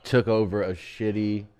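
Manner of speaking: breathless and quiet after laughter; winded from laughing, low voice; after laughing hard. Real, not acted. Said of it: "I haven't laughed that hard".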